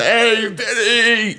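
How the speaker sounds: high, slow, drawn-out call out a window; loudmouth holler. Retold or imitated. Loudmouth Carbone calling to Vinny, high and drawn out. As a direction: bellow a neighbor's name like a loudmouth Italian guy. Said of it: "hollers out the window".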